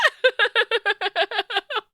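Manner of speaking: shrieking delighted laugh; high laughing squeal. Real, not acted.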